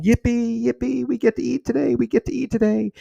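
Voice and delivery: put-on high, loud, squealing child voice of pure joy; little girl's joy. Retelling how the little girl jumped for joy, high excited child voice. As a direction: pitch way up and bounce it like a happy little kid.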